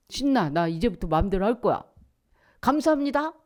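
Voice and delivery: high, loud, hurried mock delight; gleeful child. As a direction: crow about being set free. (들뜬 아이처럼 신나게)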